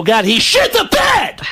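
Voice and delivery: high, slow screamed punchline; Sam Kinison. Acted. Comic as Kinison mocking bad reviews, drawn-out scream. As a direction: shriek it, stretching every word. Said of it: "Sam, when you had sex".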